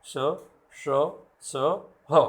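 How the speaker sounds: gentle careful demo of श स ह; fricatives demo. Shown as a demonstration. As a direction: let the class hear श स ह one by one. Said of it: "उच्चारण आप अच्छी तरह से सुनेंगे".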